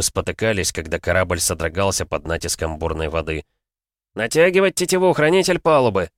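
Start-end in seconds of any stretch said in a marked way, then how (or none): 4.1-6.1 loud high battle shout. A narrator's reading. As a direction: yell the order over the storm. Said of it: "крикнул Гавит". (громкий крик)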